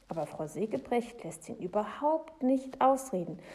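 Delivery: plain reading voice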